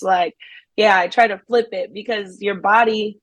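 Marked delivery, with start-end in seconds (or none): none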